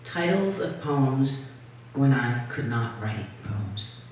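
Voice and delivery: slow, deliberate title announcement; poem reading. Acted. Poet introducing titles of poems, measured poem reading. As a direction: announce the poem's title slowly, dropping at the end.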